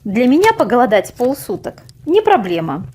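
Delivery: plain reading voice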